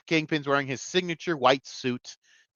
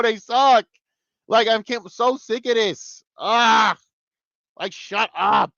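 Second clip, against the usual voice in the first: higher and louder, fed up and whiny; exasperated rant; 9 semitones higher, 5 dB louder, slower than usual. Real, not acted. Fed-up viewer rants at the annoying characters, exasperated. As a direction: let the frustration spill over. Venting about the female characters.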